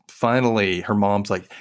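ordinary delivery

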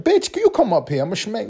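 high mock thug threat, pitch way up; the pastor's invite translated into a threat; what he really meant. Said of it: "That's how he sounded".

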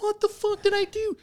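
very high-pitched bewildered protest; retold indignant protest. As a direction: replay your protest in a high voice. Retold protest while being searched.

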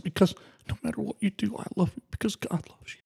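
real emotion cracking through the words; voice breaking. Real, not acted. Telling Nicole he loves her, choked up and tearful. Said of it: "I did promise I'd get emotional".

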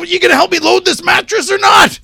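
very loud, high mock yell; shouted, exasperated question; retold angry demand. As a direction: imitate someone demanding help mid-fight. Retold demand amid argument.

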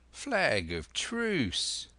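high, very drawn-out call; long pirate hail, falling at the end; crying out. A narrator's reading. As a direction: call it across a distance, stretching each word. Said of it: "cried Silver".

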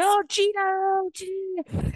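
high, slow, breathy call of "Gina"; imitating Paris calling Gina. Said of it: "she's like".

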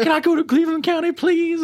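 high, whimpering begging; imitating crying plea. Arrested drunk pleading with the officer for a different jail, crying. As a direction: beg through tears like a drunk. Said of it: "As he's, like, crying".

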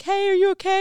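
high, loud, sugary mock concern; a bright sing-song check-in; mock sweet question. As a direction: sing it up high like fake niceness. Mock sweet check-in on angry friend.

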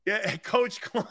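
saying the name through a laugh. Real, not acted. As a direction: laugh while naming the coach.

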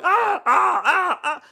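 high, loud hammed-up wrestling yelps; mock struggle cries. Mocking actors wrestling the stuffed baboon, hammed-up yelps. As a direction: yelp while wrestling a stuffed toy.

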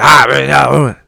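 imitating a worked-up, angry grumble; mock angry outburst. Host showing listeners how anger takes over, mock outburst. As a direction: briefly act out flaring up. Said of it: "You get angry".

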